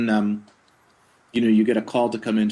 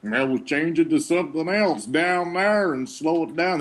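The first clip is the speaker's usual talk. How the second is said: put-on slow voice; 7 semitones higher, slower than usual. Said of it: "and you go".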